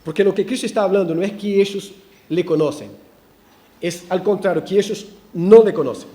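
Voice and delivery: normal talking voice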